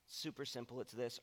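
ordinary talk, nothing marked

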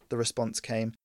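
usual speaking voice

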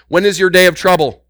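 fervent preaching climax; building shout. Real, not acted. Preacher escalates on trouble.

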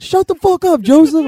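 high, loud holler; yelling dad. Retold or imitated. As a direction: bellow it from behind a door. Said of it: "And I just heard".

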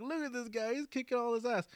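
high-pitched acted awe; mock a wowed fan watching a fight; put-on high excitement; imitated excited viewer. Voices character watching martial arts films.

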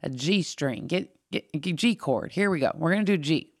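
lightly voiced Southern guitar teacher; Southern accent. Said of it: "he had this really thick Southern accent".

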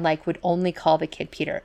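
ordinary talk

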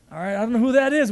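usual speaking voice

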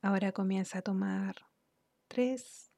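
unhurried, soothing instructions that ease the listener into relaxation; guided meditation. Real, not acted. Guide leads listener through relaxing the body, calm guided meditation.

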